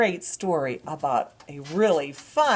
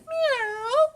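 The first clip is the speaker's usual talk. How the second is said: high squeaky meow; cat meow. Acted. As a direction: meow like a little kitten. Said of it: "Can you say that again?".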